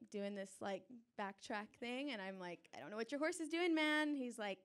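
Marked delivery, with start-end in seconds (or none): 2.8-4.1 higher and louder, fed up with the guide; exasperated call-out; exasperated outburst. Retold or imitated. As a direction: throw up your hands at the horse guide. Frustrated at the riding guide.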